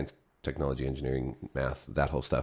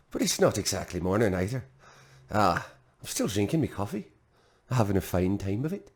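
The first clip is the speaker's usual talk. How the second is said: a light mock Irish lilt, easygoing; lilting Irish accent; 3 dB quieter than usual. Sipping coffee, chatting to viewers in mock Irish accent. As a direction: play the cheerful Irishman over morning coffee, lightly. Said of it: "go right into a Scottish accent from the Irish".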